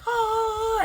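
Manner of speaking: high, crying oh; a hammed-up little wail; wife breaking down at the news, in a high sob; mock sob. Martha crying.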